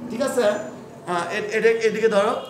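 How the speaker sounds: ordinary delivery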